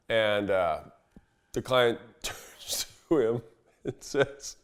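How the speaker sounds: normal talking voice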